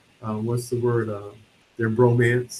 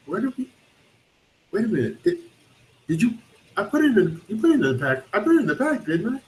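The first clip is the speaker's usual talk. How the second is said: high-pitched, halting mock fluster; worried searching; 10 semitones higher, faster than usual. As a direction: act out dawning alarm, stumbling over words. Voicing frantic search for machine.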